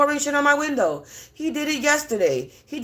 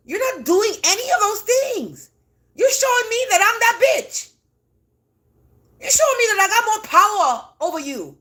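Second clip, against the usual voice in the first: defiant rant; 7 semitones higher than usual. Real, not acted.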